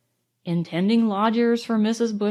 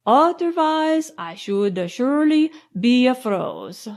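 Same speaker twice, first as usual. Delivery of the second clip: put-on deliberate foreign-accented English; careful foreign accent; 2 semitones higher than usual. A narrator's reading. Count wrapped in the blanket by his hostess, careful foreign accent. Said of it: "in careful English with an accent".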